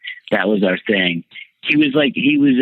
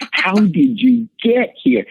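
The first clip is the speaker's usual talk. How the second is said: much higher, slow, stretched-out disbelief; retold shocked question; 13 semitones higher, slower than usual. Demanding how the men got in, high slow disbelief. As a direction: relive the outrage with a high slow plea. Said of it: "I'm like".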